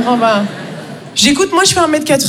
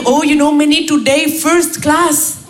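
blissful colleague; slower than usual. Retold or imitated. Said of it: "il arrive".

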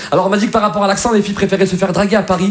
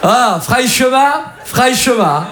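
slow, stretched words, pitched up; high, lingering Marseille come-on; drawn-out catcall; 4 semitones higher, much slower than usual. Acted. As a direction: draw out each word like a heavy-handed flirt. Acted Marseille pick-up line.

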